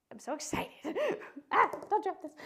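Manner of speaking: giddy, laughing and wired; buzzing on caffeine, giggling; too much caffeine. Real, not acted. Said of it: "the caffeine is kicking in".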